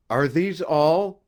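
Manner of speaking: wavering disappointment. Acted. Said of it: "with a wavering accent of disappointment".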